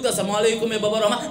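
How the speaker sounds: "Assalamualaikum ya babar rahmah" rattled off fast; rushed greeting. Retold or imitated. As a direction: hurry through the words. Groom retells his greeting quickly.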